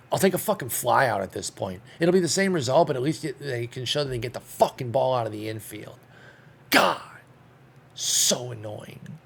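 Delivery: boil over at the offense; real anger. Real, not acted. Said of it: "Sorry, I'm so angry".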